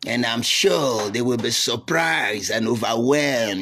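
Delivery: lightly voiced slow, measured statesman; a hint of old Ojukwu savouring his words, slow; unhurried mock-grand pronouncement; imitating Ojukwu. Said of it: "Ojukwu lick his mouth".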